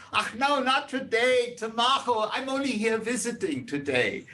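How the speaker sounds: high mock yell in an Augsburg German accent; Augsburger German accent. Soldier joking with people at windows, Augsburg German accent. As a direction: toss it off lightly in a thick Augsburg German accent. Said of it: "yells back in fluent Augsburger accent".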